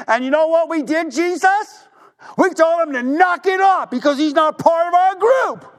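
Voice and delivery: an eager disciple bragging about shooing off a rival; excited boasting. Retold or imitated. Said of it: "he was all excited to tell Jesus this".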